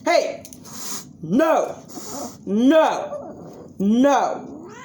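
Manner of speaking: high, firm shouts of no; sharp no. Real, not acted. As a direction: bark out no to stop them. Stopping dogs from fighting.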